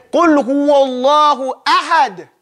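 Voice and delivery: slow Qul huwallahu ahad, final d released; recited with qalqala. Shown as a demonstration. Teacher reciting to show the released d. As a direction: recite it slowly and let the d of ahad bounce. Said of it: "But you have to say".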